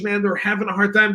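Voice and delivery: ordinary talk, nothing marked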